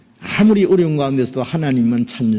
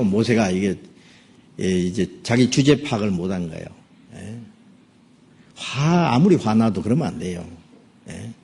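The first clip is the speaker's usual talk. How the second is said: ordinary talk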